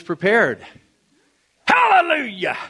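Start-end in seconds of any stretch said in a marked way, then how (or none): 1.7-2.6 high-pitched, exuberant holler; playful hallelujah shout. Pretend. Preacher back from New York hollering to congregation, playful shout. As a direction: cry it out high, in fun.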